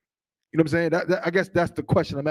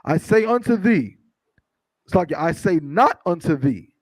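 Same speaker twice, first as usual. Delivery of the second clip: slow, measured scripture reading; 4 dB louder, slower than usual. Acted. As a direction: read the verse out slowly, weighting each phrase. Reads scripture aloud to congregation.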